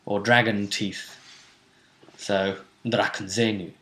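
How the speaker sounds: ordinary delivery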